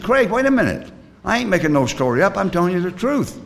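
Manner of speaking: high-pitched, insistent retort; indignant protest. Acted. Storyteller defends story to doubting friend, mock indignant.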